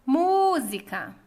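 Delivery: "Música" called loudly, MÚ drawn out; calling out the word. Shown as a demonstration. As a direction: call out "Música", holding the MÚ long. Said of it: "esse exercício de chamar as palavras".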